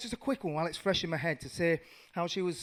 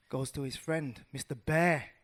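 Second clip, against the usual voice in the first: ordinary talk, nothing marked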